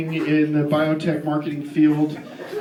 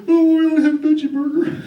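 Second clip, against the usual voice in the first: high, loud mocking clerk voice; mock the smug counter guy; snooty clerk; 9 semitones higher, 4 dB louder than usual. Fast food clerk refusing a real burger, mocking high voice. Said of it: "And he goes".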